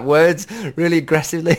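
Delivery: ordinary delivery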